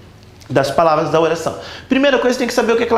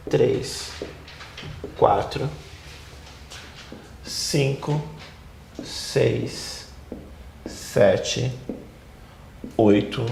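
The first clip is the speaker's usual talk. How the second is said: low, soft count with long pauses; counting to oneself; 8 semitones lower, 9 dB quieter, much slower than usual. Real, not acted. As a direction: count off slowly under your breath.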